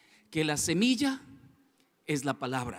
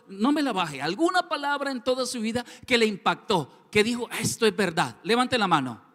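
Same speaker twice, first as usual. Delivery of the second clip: fervent sermon, raised voice; preaching; 4 semitones higher, 6 dB louder than usual. Acted. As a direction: preach it out to the congregation. Preaching at full force. (predicando con fervor)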